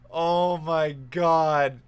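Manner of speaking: drawn-out, very slow oh my God; stunned in pain. Retold or imitated. Narrator just struck in the eye, slow and stunned. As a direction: stretch it out, shaken by the hit.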